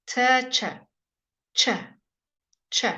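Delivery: short t sliding into ch; teacher models the ch sound. Shown as a demonstration. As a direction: start a t, release it as ch. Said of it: "It's like a t".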